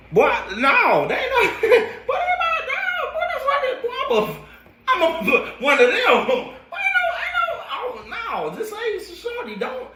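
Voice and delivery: very high, laughing protest; laughing denial. Real, not acted. Denying being her boyfriend.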